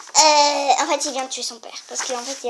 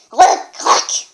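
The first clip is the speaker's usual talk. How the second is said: sphinx croak; 7 dB louder than usual. Acted.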